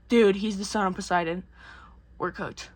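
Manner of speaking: lightly acted hopeless verdict; mock resigned dread. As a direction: let it drop to a soft, doomed finish. Crew realizing they are doomed.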